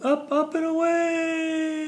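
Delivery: high, loud, stretched rising call; soaring up and away. Acted. Showing the kids the balloon, a high soaring call. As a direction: lift the voice up like the balloon floating off.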